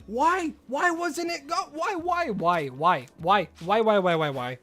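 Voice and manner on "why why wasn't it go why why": high, drawn-out whining why; frustrated wail. Real, not acted. Streamer wailing at a failed catch. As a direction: whine it out in disbelief.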